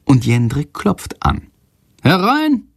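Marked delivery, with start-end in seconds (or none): 2.0-2.6 high, raised call through a door; lightly voiced headmaster. A narrator's reading. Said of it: "Direktor Lersikows Stimme".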